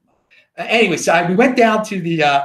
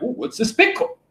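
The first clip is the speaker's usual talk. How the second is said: mock curious friends; 9 semitones higher than usual. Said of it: "And they're like".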